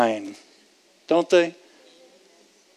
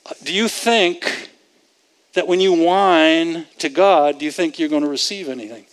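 ordinary talk, nothing marked

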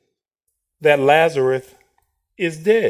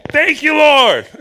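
higher and louder, joyful cry; mock praise; 9 semitones higher, 7 dB louder, slower than usual. Preacher mimicking a thankful believer, loud and high. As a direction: burst out in thanks.

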